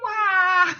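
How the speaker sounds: high, long wail of falling; falling cry. Acted. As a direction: wail as you drop through air.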